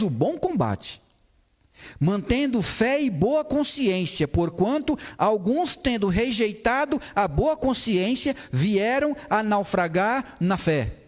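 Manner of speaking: slow, solemn Bible reading; scripture reading. Acted. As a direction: read the verse slowly and gravely. (leitura bíblica solene e lenta)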